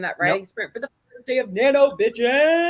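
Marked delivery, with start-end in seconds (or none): 1.5-2.7 high, loud, drawn-out cheer; rousing cheer. Real, not acted. Cheering the first day of the writing sprint, rousing cheer. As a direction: whoop it out, stretching the words.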